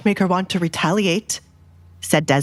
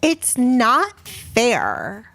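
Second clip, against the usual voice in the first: put-on high, sulky girl, dragged out slowly; grumbling daughter; 6 semitones higher, slower than usual. Jealous daughter grumbles to her parents about the Duke, high and sulky. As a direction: whine about the unfairness through a pout. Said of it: "Kate grumbled".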